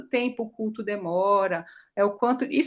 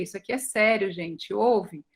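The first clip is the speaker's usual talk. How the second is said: usual speaking voice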